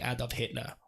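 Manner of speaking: a hint of a German accent, said softly; lightly put-on German accent on Adolf Hitler. Shown as a demonstration. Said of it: "I say it in my German accent".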